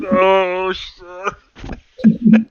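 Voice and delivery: loud, high drawn-out mock groan; waking up hungover. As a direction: wail it like a wretched morning after. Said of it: "You wake up like".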